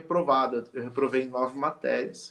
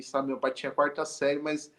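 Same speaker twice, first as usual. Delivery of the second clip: normal talking voice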